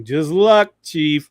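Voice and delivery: loud, knowing cartoon-hero quip; playful Clark imitation. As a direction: punch it out with a wink in the voice. Clark's cheeky winking reply acted out.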